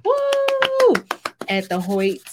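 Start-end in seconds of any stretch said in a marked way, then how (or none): 0.1-0.9 high, loud whoop of joy; excited cheer. Real, not acted. Cheering for their movie premiere, high and loud. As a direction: let out a big celebratory woo.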